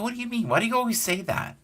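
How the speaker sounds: high-pitched, protesting younger self; mock indignant. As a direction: whine the question up high. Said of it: "I got angry one time".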